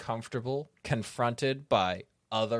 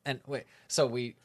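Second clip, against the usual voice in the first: ordinary delivery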